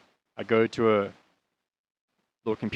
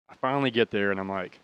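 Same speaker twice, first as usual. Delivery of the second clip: ordinary delivery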